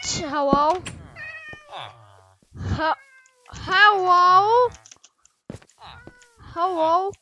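loud, high questioning call. Real, not acted. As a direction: holler to see if anyone is there. Calling out to someone.

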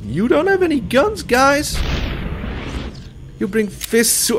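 high, mocking taunt. Real, not acted. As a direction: tease the unarmed enemies. Taunting unarmed enemies.

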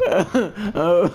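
high, laugh-choked groan; real helpless laughter breaking out; laughing hard. Real, not acted. Streamer overcome by his own joke, laughing hard. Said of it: "I'm laughing so hard".

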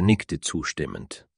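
ordinary delivery, nothing marked

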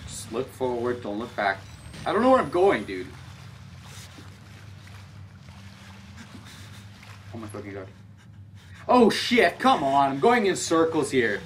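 panicky, rising voice; lost and panicking; being chased. Real, not acted. Being chased in dark halls.

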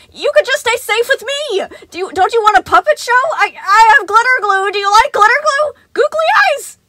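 very high, loud, desperate bribes; frantic coaxing. A narrator's reading. Sun chasing Gregory, shrieking offers of crafts. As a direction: lure a kid back in a panic.

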